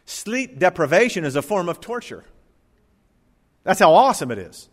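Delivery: ordinary talk, nothing marked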